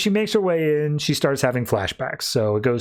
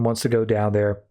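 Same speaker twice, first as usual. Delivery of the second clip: ordinary delivery, nothing marked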